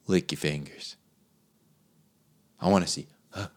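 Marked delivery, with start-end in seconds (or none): none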